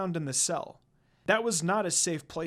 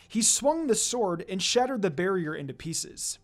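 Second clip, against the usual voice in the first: ordinary delivery, nothing marked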